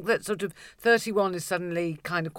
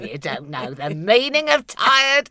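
slow, loud mock indignation; mock indignant; 5 semitones higher, 9 dB louder than usual. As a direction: scold with mock outrage. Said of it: "And I said to him".

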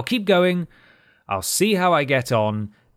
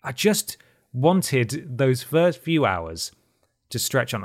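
ordinary talk

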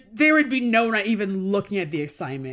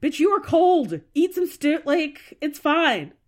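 higher, louder sassy comeback; sassy retort; 8 semitones higher, 4 dB louder, slower than usual. Pretend. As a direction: fire it back with attitude. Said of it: "but also just like".

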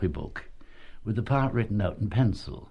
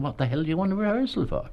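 higher, fast, scoffing disbelief; incredulous tough boss; 8 semitones higher, faster than usual. Retold or imitated. Manager repeats his scoff at the actor, higher and fast. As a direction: toss off the question with a scoff. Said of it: "this terribly tough Dublin man called Fred".

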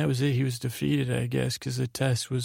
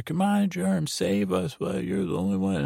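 crowd urging; 6 semitones higher than usual. Retold or imitated. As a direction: egg him on like a cheering crowd.

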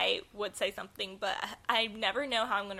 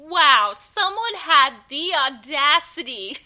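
higher, louder, slow sarcastic outrage; mock indignation; 5 semitones higher, 11 dB louder, slower than usual. Friend voicing the coworker's thoughts, mock indignant. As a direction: lay the sarcasm on thick. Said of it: "I bet you're thinking".

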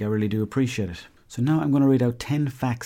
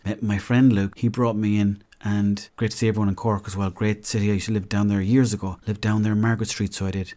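usual speaking voice

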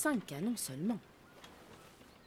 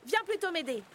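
mother's call; 9 semitones higher, 4 dB louder than usual. Acted. Mother calling to son.

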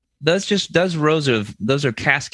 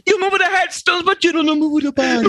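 high, hammed-up Italian accent; 12 semitones higher, faster than usual. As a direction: rant in an over-the-top Italian accent. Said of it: "I'm doing an accurate Italian accent".

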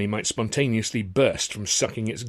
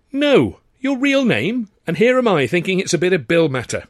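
a showgirl amazed his name is real; surprised disbelief; 10 semitones higher, 10 dB louder than usual. A narrator's reading. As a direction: squeal it, you can't believe it. Tilly voiced by narrator.

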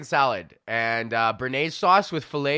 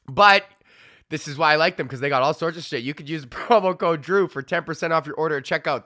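upbeat podcast sponsor read; 2 semitones higher, 4 dB louder than usual. Acted. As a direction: pitch it like a radio ad. Reads sponsor ads.

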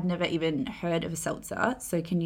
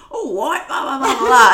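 loud, high mock-British blather; hammed-up British impression, pitched up and boisterous; put-on British accent; 7 semitones higher, 12 dB louder than usual. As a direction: mimic a fake English accent, laughing. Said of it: "People really put it on".

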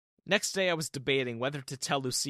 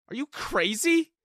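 much higher, loud outraged cry; cried out; 12 semitones higher, 7 dB louder, slower than usual. Acted. Terrence yelling at Diana in the stuck elevator, outraged. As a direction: throw the question at her in disbelief. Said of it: "I cried".

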